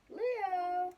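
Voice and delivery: put-on loud, high call for a lost boy; calling Leo across the beach, worried. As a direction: shout his name, searching.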